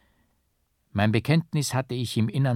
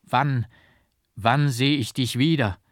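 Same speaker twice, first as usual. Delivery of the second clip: urgent call; 4 semitones higher, 5 dB louder than usual. A narrator's reading. Monk calls after painter.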